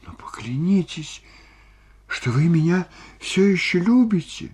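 high, breath-caught plea; desperate high-pitched entreaty; tearful begging. A narrator's reading. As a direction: implore through tears, voice rising. Weeping woman begs for oath of love.

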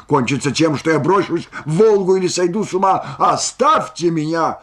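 loud, high, desperate shouting; explode, climbing in pitch and volume; frantic tormented yell; furious outburst. A narrator's reading. Tormented painter bursts out.

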